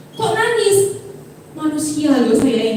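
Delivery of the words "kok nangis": surprised question. Retold or imitated. Imagined listener questions crying.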